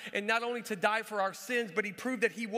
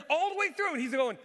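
normal talking voice

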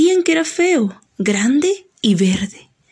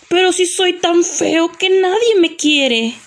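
self-doubting ogre; 7 semitones higher than usual. Acted. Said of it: "El ogro le dice al hada".

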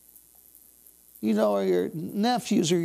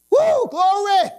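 high, loud, slow shout of praise; ecstatic cry; 11 semitones higher, 8 dB louder, slower than usual. Real, not acted. Preacher crying out in praise, ecstatic loud cry. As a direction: cry out in joy.

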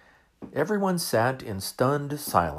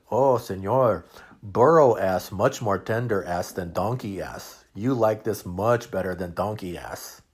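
put-on smiling Mexican accent; 5 dB louder than usual. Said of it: "Ramon smiled".

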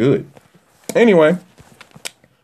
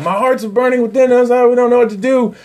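much higher, breathless disciples; high, hurried mock amazement; excited disciples; 10 semitones higher, faster than usual. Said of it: "and they're like".

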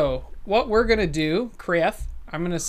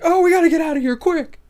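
panicked critter; 11 semitones higher, 5 dB louder, faster than usual. Acted.

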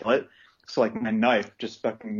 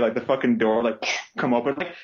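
ordinary delivery

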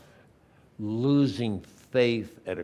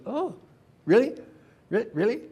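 mock surprise; 10 semitones higher, faster than usual. Peter's acted exchange with Jesus.

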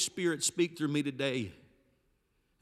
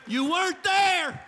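high, loud and slow; slow shouted climax line; loud slow declaration; 13 semitones higher, 7 dB louder, slower than usual. Acted. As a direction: ring it out over the congregation. Climax line before the fade.

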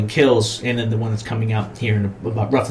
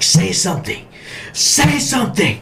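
a doctor pushing a silent patient to answer; rising demand; 7 semitones higher than usual. Retold or imitated. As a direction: pitch climbing, slower, pressing harder. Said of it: "starts screaming".

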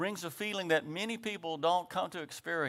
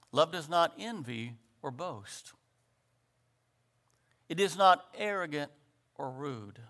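phrase-by-phrase scripture reading; slow, deliberate reading. Shown as a demonstration. Preacher reading Corinthians so each phrase sinks in, slow reading. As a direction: read it out with a pause after each phrase. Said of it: "a little phrase by phrase".

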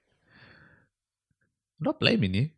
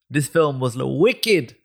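louder, higher put-on street slang accent; 3 semitones higher, 3 dB louder than usual. Friends joking on a podcast, in a put-on street accent. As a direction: ham up a street accent to describe the film. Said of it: "I don't know what accent you're doing".

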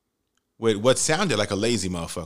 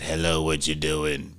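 much lower, slow mock deep voice; deep-voiced woman; 9 semitones lower, 3 dB quieter than usual. Date greets him on the phone in a mock deep voice. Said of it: "and her voice was deep as fuck".

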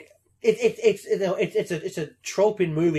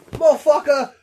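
hammed-up furious dad, higher and louder; angry father; 7 semitones higher, 7 dB louder than usual. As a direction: rant at the lazy son. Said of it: "Pierce Brosnan just be like".

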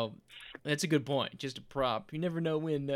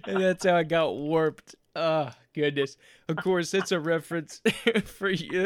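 talking through a real laugh; amused laughter; 3 semitones higher, 5 dB louder than usual. Real, not acted. Host reacting to co-host's bookshelf joke, laughing. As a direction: recover from laughing while carrying on.

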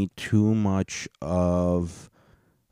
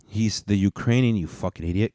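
usual speaking voice

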